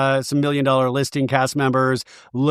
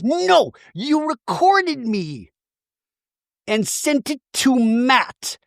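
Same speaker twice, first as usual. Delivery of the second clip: high and slow, mock-wounded; Shannon impression; 8 semitones higher, much slower than usual. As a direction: stretch it out in a high hurt whine. Voicing Shannon in the fight.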